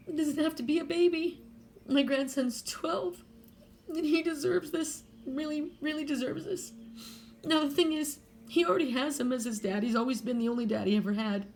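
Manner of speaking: near tears, pushing through for her grandson; tearful. Real, not acted. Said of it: "I'm very emotional right now".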